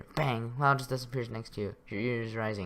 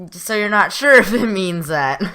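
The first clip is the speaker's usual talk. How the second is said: louder and higher, laughing at the text; amused outburst; 6 semitones higher, 12 dB louder than usual. Real, not acted. Gamer reacting loudly to turkey game text, amused. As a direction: crack up at the silly line.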